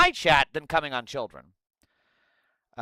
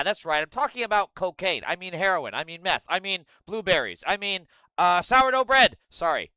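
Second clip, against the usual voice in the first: ordinary talk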